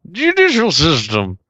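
high, slow comic impression voice; drawn-out celebrity send-up; Dana Carvey impression. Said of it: "that's an old Dana Carvey joke".

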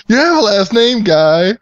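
quoting movie line. Retold or imitated. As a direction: sneer it way up high. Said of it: "my favorite part".

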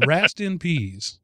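"Rast in Peas", slowly and quietly; slow, soft reading of the card. Shown as a demonstration. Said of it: "R A S T in P E A S".

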